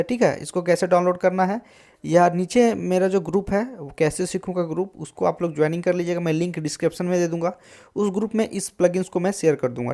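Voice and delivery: ordinary talk, nothing marked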